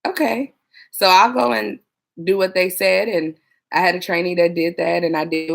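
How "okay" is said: higher, reluctant okay; grudging okay. Retold or imitated. Dispatcher humouring a trainee, grudging okay. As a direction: give in with a sigh of doubt. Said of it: "And I'm like".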